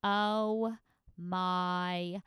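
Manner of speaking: nasal drawn-out Janice "Oh my God", loud at the end; Janice impression. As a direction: stretch it out like Janice from Friends, punching God. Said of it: "if I did the impression right now".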